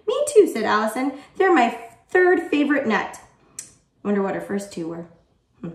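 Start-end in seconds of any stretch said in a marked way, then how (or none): 0.1-3.2 high, louder excited chime-in; eager pupil. Acted. Pupil agreeing about her favorite nuts, eager and high. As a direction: jump in high and keen.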